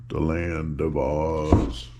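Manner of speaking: low, slow dramatic title; low, drawn out, mysterious title. Shown as a demonstration. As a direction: drop the voice for the title.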